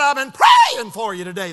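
loud, whooping voicemail preacher; phone message impression. Preacher repeats a friend's encouraging message, loud whooping impression. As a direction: mimic a hollering preacher, leaping on key words. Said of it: "That's just the way it sounded".

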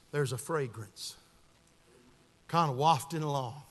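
low, soft, lingering preacher's narration; hushed sermon storytelling. Real, not acted. Preacher picturing Paul far from Philemon, hushed storytelling. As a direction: draw listeners in quietly with long pauses.

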